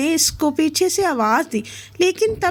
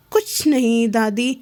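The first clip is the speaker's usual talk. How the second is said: ordinary delivery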